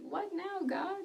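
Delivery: high, sing-song mock helplessness; retold bewildered question. Woman asks God for the next step, high bewildered query.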